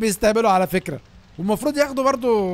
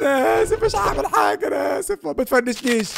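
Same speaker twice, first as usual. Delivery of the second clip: shrieking apology; 5 semitones higher than usual. Real, not acted. Begging after being thrown out.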